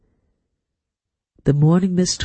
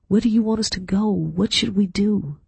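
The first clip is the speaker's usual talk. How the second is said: higher-pitched anxious questions; a touch of pleading, pitched up; 6 semitones higher than usual. A narrator's reading. Daughter asking her dead mother for guidance, mildly pleading.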